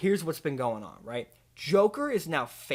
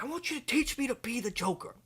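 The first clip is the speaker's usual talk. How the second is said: high, eager pleading voice; Larry David; 9 semitones higher, 5 dB quieter than usual. Acted. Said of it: "Larry David's like".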